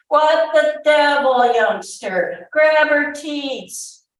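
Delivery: loud, high-pitched bullying roar. A narrator's reading. Soldier swearing at young William over milking, roaring. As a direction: roar at the youngster, stretching the last words. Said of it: "the man roared his bad words".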